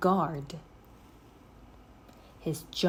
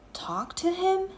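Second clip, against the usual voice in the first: higher, disbelieving echo; incredulous; 12 semitones higher than usual. A narrator's reading. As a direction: Jack can't believe Annie's plan, incredulous. Jack incredulous.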